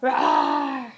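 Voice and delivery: put-on loud playful roar for the baby; lion roar. Shown as a demonstration. Mother roaring like a lion for her baby. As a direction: give a big show roar. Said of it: "can you say what a lion says".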